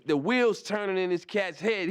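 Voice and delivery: ordinary talk, nothing marked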